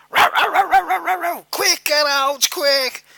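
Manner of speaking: high loud imitated barking, then urgent shouts; dog barking and shouting. Replaying the tape of the dog attack, high loud barks and shouts. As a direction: bark like the dog, then yell quick. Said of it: "You heard me shouting".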